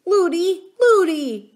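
put-on high, loud child's call; crying out. A narrator's reading. Frightened princess calling to her nurse, crying out. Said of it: "cried the princess".

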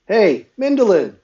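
high, loud call down a hall; yelling. A narrator's reading. Someone calling from the outside hall, yelling. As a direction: holler to get someone's attention. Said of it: "someone yelled in the outside hall".

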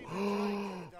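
long, high gasp; real drawn-out gasp of shock. Real, not acted. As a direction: suck in air, aghast. Shocked by something in the game.

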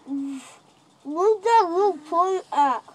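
slow, through stuffed cheeks; mouth full. Real, not acted. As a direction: talk around a mouthful.

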